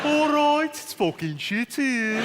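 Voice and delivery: high, slow Brummie greeting and moan; Birmingham accent. Retold or imitated. Comic combining both Birmingham phrases, high Brummie. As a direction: stretch it out in broad Birmingham. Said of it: "phrase ... in Birmingham".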